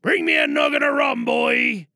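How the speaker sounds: barking sea captain. Acted. Captain roaring at the boy for his rum. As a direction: bark it like an old pirate captain.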